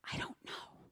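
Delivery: toneless whisper, almost no voice; whispered admission. Retold or imitated. Mother whispering her doubt to God about trusting him. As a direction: confess it under your breath.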